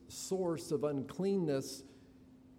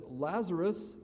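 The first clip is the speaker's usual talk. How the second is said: raised, higher call; loud voice; 5 semitones higher, 6 dB louder than usual. Retold or imitated. Jesus calling to Lazarus outside the tomb, loud voice. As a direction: call out the name loudly. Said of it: "in a loud voice".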